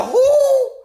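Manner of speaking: very high, loud yell of Who; a very high, loud shocked scream. Retold or imitated. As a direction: scream it in total disbelief. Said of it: "I'm sorry to yell".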